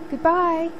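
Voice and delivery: high, loud call, held on; calling goodbye. A narrator's reading. Sophie calling goodbye again from the sleigh, loud. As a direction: call again as she flies away. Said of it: "she called".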